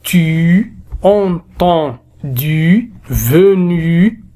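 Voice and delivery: "Tu", "Entendu", "Venu" slowly, final u held; drawn-out final u. Shown as a demonstration. Teacher presenting the u sound, "Tu", "Entendu", "Venu" with long endings. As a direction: stretch each final u, letting it rise. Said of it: "nous avons le son".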